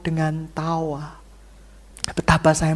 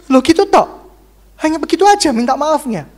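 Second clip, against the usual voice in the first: higher, louder mock indignant whine; offended drama seeker; 7 semitones higher, 6 dB louder than usual. Congregant mocked for wanting a dramatic apology, indignant whine. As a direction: whine like someone cheated of a show.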